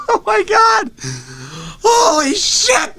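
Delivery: high, slow, tearful shout; mock sobbing wail. Real, not acted. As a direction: cry out in comic anguish. Comic despair outburst.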